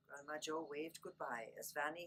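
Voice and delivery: plain reading voice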